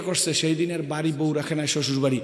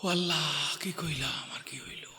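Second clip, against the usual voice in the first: hushed, breathy whisper of regret; whispered lament; 11 dB quieter than usual. Retold or imitated. Father finally lamenting to God, whispered. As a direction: whisper it like a broken man. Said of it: "শেষে কয় যে".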